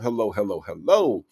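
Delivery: ordinary talk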